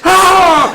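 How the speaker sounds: high, loud yelp of pain; mock pained yell. Player yelping at his coach after the spray. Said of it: "He starts screaming at me".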